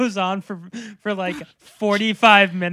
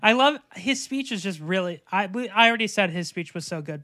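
ordinary talk, nothing marked

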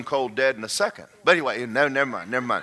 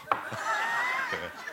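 squeaky high laugh; high squeal of laughter; 10 dB quieter than usual. Real, not acted. Laughs after "gotta go.

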